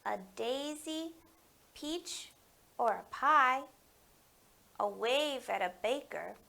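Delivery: gentle picture-book read-aloud; story reading to children. Acted. Librarian reading Finding Kindness aloud at story time.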